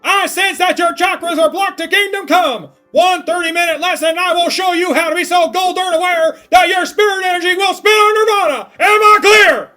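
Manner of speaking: high, loud barking drill sergeant. Acted. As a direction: bark it at a recruit. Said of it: "This sounds like a drill instructor".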